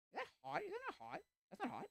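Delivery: high squeaky imitation of her disbelief; mock shocked woman. Woman at the fire pit asks, squeaky disbelief. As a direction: pitch way up like a startled lady. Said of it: "she looks at me and goes".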